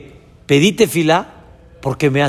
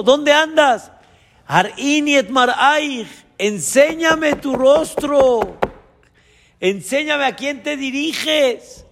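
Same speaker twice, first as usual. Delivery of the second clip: much higher, longing call, slow on the Hebrew; God calling his child; 9 semitones higher than usual. Acted. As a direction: call out like a father searching for a lost son. God searching for distant child.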